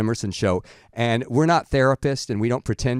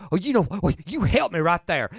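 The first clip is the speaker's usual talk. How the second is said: ordinary talk, nothing marked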